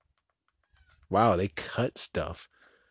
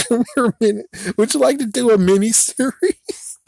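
much higher, louder sing-song lure; creepy high voice; 11 semitones higher, 7 dB louder than usual. Pretend. Grim Reaper luring the Chucky voice actor, creepy high singsong. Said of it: "It's like".

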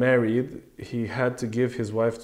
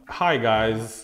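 usual speaking voice

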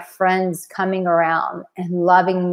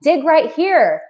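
encouraging guide; 8 semitones higher than usual. Acted. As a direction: call out like pointing to buried treasure. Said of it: "and going".

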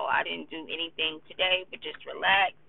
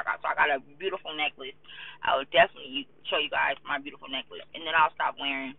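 ordinary talk

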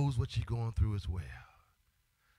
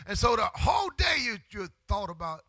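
sung, shouted preaching climbing higher and louder; full-force preaching; 8 semitones higher, 5 dB louder than usual. Acted. Preacher stirring the congregation to cry out, shouting. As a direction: build the sermon into a singing shout.

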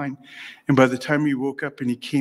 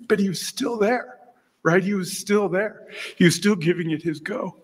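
high-pitched, tender insistence; moved; 7 semitones higher than usual. Real, not acted. Speaker recalls his struggling companion staying on, moved. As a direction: voice lifting high with feeling.

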